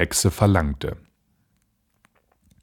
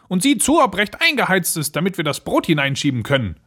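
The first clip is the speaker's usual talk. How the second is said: commanding witch; 10 semitones higher, faster than usual. Acted. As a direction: give orders in a high witch voice. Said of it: "sagte die Hexe". (befehlende Hexe)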